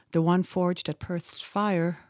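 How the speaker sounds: usual speaking voice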